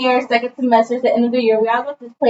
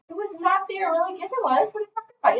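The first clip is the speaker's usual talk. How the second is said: high, softer put-on protest; mock bickering; 6 semitones higher, 7 dB quieter than usual. Friends gaslighting her about the phone, mock bickering. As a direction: imitate friends squabbling in high voices.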